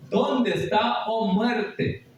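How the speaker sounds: high, very drawn-out dramatic question; rhetorical question. Acted. Preacher challenging death with a long, high rhetorical question. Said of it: "está haciendo una pregunta".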